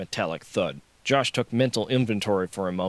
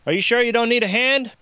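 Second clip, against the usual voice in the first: high, loud raised voice; shouted offer; 13 semitones higher, 7 dB louder than usual. A narrator's reading. Josh offering the guard help, shouting. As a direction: shout the question across.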